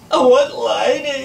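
slow, high, loud whine; acted sob of a little boy wanting his toy; sobbing toddler. A narrator's reading. Little brother crying for sister's toy, sobbing. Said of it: "he sobbed".

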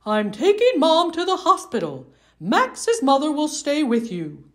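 father leaving for hospital; hurried dad voice. Acted. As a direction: tell him quickly on the way out.

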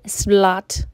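ordinary delivery, nothing marked

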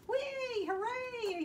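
very high, squealed cheer; excited cheering. Acted. Froggy hears about presents, high excited cheer.

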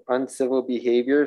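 ordinary talk, nothing marked

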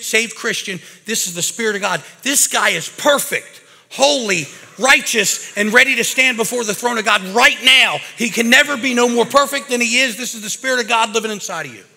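high, loud preaching build; full-force preaching. Acted. Preacher proclaims the Spirit is perfect, full force. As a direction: lift it like a revival preacher.

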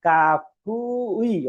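কাপুই pulled out very slowly, each sound held; slow pronunciation demo. Shown as a demonstration. Teacher isolating the sound for students, very slowly. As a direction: draw out কাপুই syllable by syllable. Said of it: "কি হইল এটা?".

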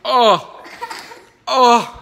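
loud pained laughing gasps. Real, not acted. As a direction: laugh through the burn. Pain from hot pepper.

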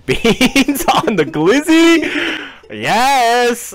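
excited. Real, not acted. Echoing friend's thrilled outburst.

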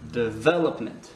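"development" with the second syllable VEL stressed. Shown as a demonstration. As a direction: hit VEL in "development", rest light. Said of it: "on accentue le V". (accentué sur « vel » dans « development »)

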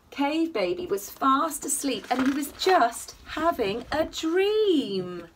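ordinary delivery, nothing marked

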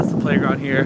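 usual speaking voice

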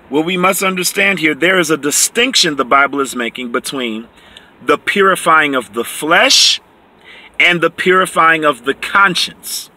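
normal talking voice

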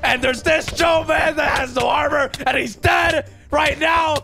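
frantic. Real, not acted. Panic as players drown.

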